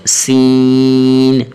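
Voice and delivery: long chanted سین; drawn-out seen. Shown as a demonstration. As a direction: stretch the سین out slowly. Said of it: "تھوڑا سا سین کو کھینچیں گے".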